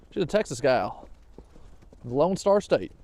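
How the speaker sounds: put-on a weak, half-hearted Texas accent; terrible Texas accent. Vlogger joking about her dog being a Texas gal, mock Texas accent. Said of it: "That was a terrible Texas accent".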